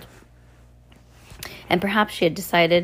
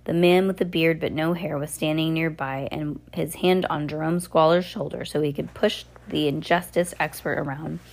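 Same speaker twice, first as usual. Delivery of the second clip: plain reading voice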